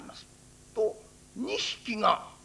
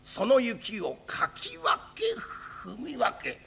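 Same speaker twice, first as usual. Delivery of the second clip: ordinary delivery, nothing marked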